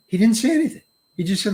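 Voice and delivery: usual speaking voice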